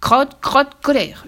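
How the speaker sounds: loud, high exasperated shout; angry yell. A narrator's reading. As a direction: yell it in a temper. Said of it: "hurla le duc". (hurlement de colère)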